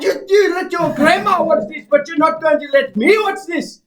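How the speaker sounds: high, loud outraged stepdad; stepdad impression. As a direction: yell it high and indignant. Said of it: "And that's what he sounds like".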